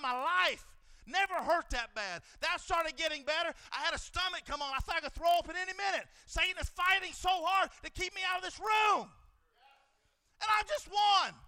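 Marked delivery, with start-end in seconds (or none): 6.3-11.3 loud, driving preacher's call; rally them for battle; shouting warfare sermon; preaching at full force. Acted.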